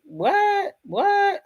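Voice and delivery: high, stunned double what; shocked disbelief. Pretend. Imagined daughter stunned at her cold dad, high disbelief. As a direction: squeal it in outrage.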